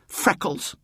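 high-pitched jeer; taunting. A narrator's reading. Francis putting out his tongue at William, taunting. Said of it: "put out his tongue".

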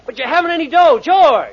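loud, high shout after someone; calling after. Acted. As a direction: yell after him as he goes. Calling after a boy leaving.

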